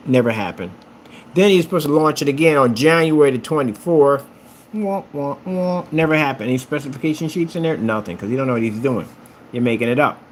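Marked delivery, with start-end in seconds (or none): 4.7-5.9 higher mock trombone wah-wah; mock the failure with a sad horn; vocal sad trombone, pitched up. Mocking second missed launch.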